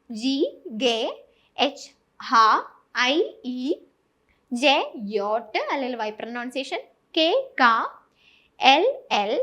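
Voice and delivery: each letter name said clearly, then its German sound; German alphabet drill. Shown as a demonstration. Tutor drilling Malayalam learners on the German alphabet, letter by letter. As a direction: spell out the German letters one by one, crisp. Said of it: "ഇതൊന്നും കൂടെ ഞാൻ പറയാം".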